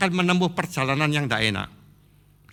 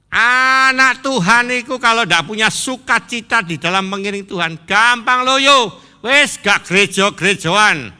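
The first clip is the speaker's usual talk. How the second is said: loud, high-pitched sermon exhortation; full-force preaching; 8 semitones higher, 10 dB louder than usual. Real, not acted. Preacher warns congregation of weakening without joy, forceful preaching. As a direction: preach it out loudly, stretching the key words.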